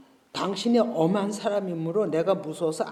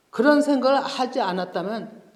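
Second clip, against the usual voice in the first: ordinary talk, nothing marked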